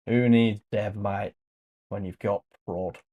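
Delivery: light mock Australian accent, bloke to bloke; a hint of an Australian mate joking. Joking to viewers about devs in an Australian accent. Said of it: "I'm putting on an Australian accent".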